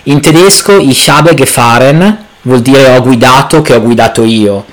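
ordinary delivery

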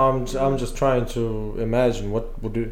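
ordinary talk, nothing marked

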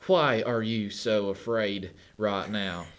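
put-on slow, drawn-out Southern American accent, pitch jumping up on the question; Matt Damon southern accent. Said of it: "you know, this southern accent".

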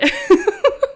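real high, loud burst of laughter. Real, not acted. Laughing at her own story for the host, a high loud laugh.

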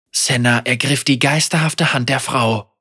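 usual speaking voice

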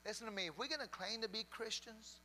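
usual speaking voice

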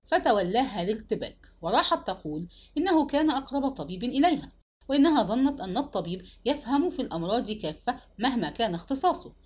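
ordinary delivery, nothing marked

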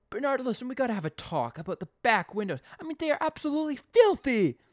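high, loud, hammed-up outrage; mock indignation rising to a loud finish; blustering complaint. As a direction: act out a shop owner making a big fuss. Acting Bart complaining about windows.

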